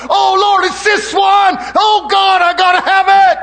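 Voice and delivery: mocking a greedy churchgoer crying out to God; mock desperate cry. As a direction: ham up the desperate wanting. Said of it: "I'm mocking you now".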